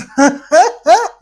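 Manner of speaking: high, loud burst of laughter; real laugh. Real, not acted. Laughing as the man offers to drive her friend, high and loud. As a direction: crack up in disbelief.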